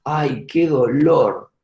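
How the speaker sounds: a lightly hammed-up patient groaning after a cough; acting the patient in pain. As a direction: drag out the patient's mock complaint. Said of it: "El paciente tose y dice".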